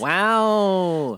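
dry, unimpressed wow; wow, said with heavy sarcasm; sarcastic wow. Real, not acted. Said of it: "That's a sarcastic wow".

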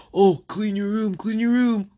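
high nagging mom voice; nagging parent. Retold or imitated. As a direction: nag like a parent. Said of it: "nagged by my parents".

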